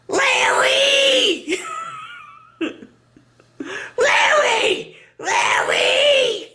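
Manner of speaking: screaming for Larry. Retold or imitated. Person at home shrieking for Larry to come to the phone. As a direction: holler for Larry like he's upstairs, laughing through it. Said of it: "and then they go".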